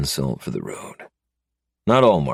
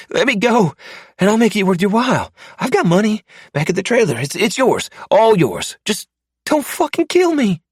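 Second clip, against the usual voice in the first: high, panicked pleading voice, fast and loud; terrified captive man; 6 dB louder than usual. Acted. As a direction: beg for your life in a frantic high voice. Narrator voices the begging mark.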